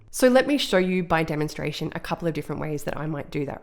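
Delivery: ordinary delivery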